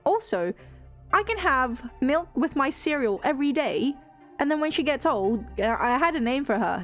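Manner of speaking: usual speaking voice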